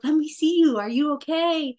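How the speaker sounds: high-pitched, fussing mama voice; anxious mama. Retold or imitated. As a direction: imitate a mother's instinctive check on her baby, voice lifted. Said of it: "the mama in me, all your instincts are like".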